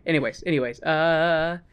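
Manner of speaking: ordinary delivery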